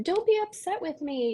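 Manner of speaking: put-on high, pleading little kid voice; pleading child. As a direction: beg like a toddler who feels scolded. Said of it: "this child is saying".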